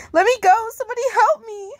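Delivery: high, frantic cry to be let go; panicked scream for help. Acted. Captive girl screams for help, high and frantic. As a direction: shriek it like she's being dragged off.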